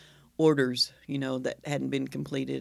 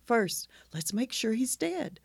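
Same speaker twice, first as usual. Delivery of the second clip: calm, soothing voice; 8 semitones higher than usual. Acted. Operator reassuring a panicked hunter, calm and soothing. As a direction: soothe like a hammed-up emergency operator. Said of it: "in this calm, soothing, soothing voice".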